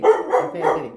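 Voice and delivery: high, bright sing-song Confetti; playful exclaim. Real, not acted. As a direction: relish the word Confetti with a playful high lilt. Repeats word playfully, sing-song.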